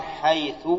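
drawn-out حيث with a short u ending; final damma on حيث. Shown as a demonstration. Teacher lists the dialect forms of the word, ending in u. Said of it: "بتثليث الثاء".

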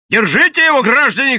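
very high, loud call to bystanders; shout. A narrator's reading. Policeman calling on citizens to seize the madman, shouting. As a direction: yell out an urgent order. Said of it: "крикнул он".

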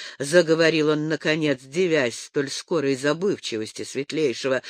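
usual speaking voice

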